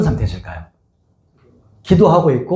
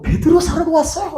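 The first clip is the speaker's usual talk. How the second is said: much higher, laughing excited shout; overjoyed girl; 14 semitones higher than usual. Retold or imitated. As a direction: burst out with a girl's joy. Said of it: "너무 기뻐가지고".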